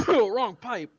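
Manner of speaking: loud, coughing out words; choking. Real, not acted. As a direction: cough through it, choked up. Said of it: "You good?".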